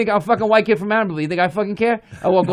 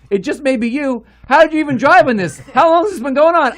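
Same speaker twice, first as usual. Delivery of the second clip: how did you even drive this, half-shouted; incredulous questions; 7 semitones higher than usual. Retold or imitated. Husband to wife about the broken car, incredulous.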